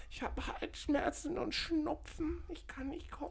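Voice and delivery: whiny sick voice. Retold or imitated. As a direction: fake a feeble sick call. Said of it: "anrufen und sagen".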